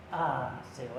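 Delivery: low, soft, defeated answer; broken sigh. Retold or imitated. Peter answering Jesus, crushed and soft. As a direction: let it fall out of him, beaten.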